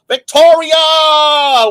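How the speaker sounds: high, loud, drawn-out cry of the name; long holler. Real, not acted. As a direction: stretch the name into a big shout. Same name hollered a second time.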